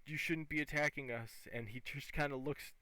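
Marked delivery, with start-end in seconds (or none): none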